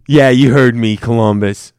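loud shout-out to the city. Acted. Calling out Columbus fans loudly. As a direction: bellow it at Columbus.

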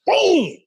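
loud, high-pitched slam noise; explosive "boom". Retold or imitated. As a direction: punch out a big impact boom. Imitates the impact of body slam.

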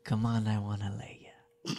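low, whispery come-on; quiet, husky flirtation in character; put-on movie character voice. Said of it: "Let me find this voice".

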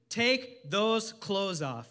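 slow, deliberate title read; sermon title said slowly; announced title. Shown as a demonstration. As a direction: space every word out. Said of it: "Title of my message is".

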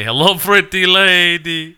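slow, high mock Indian accent; mock Indian accent, suppressing laughter. Repeating the joke for co-hosts, suppressed-laugh Indian accent. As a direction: put on an Indian accent while holding back a laugh. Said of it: "trying not to laugh and say".

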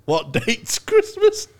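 slow, high game show host; quiz host voice. Retold or imitated. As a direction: mimic a quiz host reading out. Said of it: "where they go".

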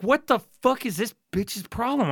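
1 retold outrage. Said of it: "I was like".

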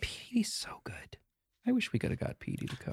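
unvoiced whisper aside. Real, not acted. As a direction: whisper it secretly to the table.

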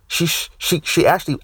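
ordinary talk, nothing marked

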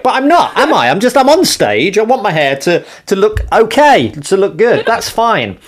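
high-pitched, flustered self-justifying; defensive. Real, not acted. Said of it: "I'm trying to defend myself".

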